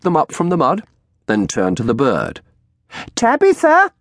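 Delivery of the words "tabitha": high, loud crone call; shrill calling out. A narrator's reading. Old woman calling her bird, shrill. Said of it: "she called out".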